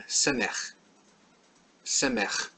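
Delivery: "semech" ending in a scraped, guttural ch; throaty ch ending. Shown as a demonstration. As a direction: finish "semech" on the hock-a-loogie sound. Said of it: "It's got that ch sound".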